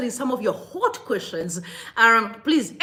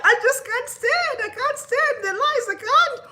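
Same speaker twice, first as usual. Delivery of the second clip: very high, slow laughing wail; overcome, voice climbing into a tearful squeal; crying with laughter; 13 semitones higher than usual. Real, not acted. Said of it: "I'm actually crying".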